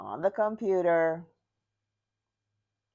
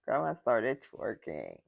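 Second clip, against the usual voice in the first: ordinary talk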